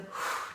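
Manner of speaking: audible breathy exhale; soft relaxing sigh; demonstrated breathing. Shown as a demonstration. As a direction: let out a long sighing breath. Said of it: "the breathing".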